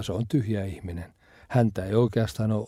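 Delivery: ordinary talk, nothing marked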